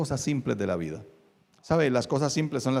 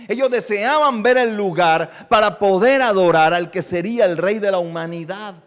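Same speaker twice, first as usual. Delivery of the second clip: raised, emphatic sermon voice, pushing key words loud; impassioned preaching; 7 semitones higher, 8 dB louder than usual. Real, not acted. Preacher urging congregation to contemplate God, impassioned preaching. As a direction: exhort the congregation at full force.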